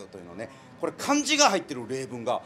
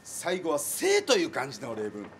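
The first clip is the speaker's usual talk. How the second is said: ordinary talk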